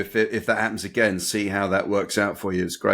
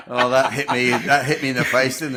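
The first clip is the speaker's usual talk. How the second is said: laughing through words; 3 semitones higher, 3 dB louder than usual. Real, not acted. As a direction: talk through real laughter, a bit louder. Still laughing at joke.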